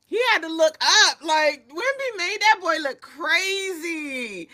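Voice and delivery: high, loud, slow hype; excited disbelief. Real, not acted. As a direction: lose it over the play. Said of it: "I am crying out of my".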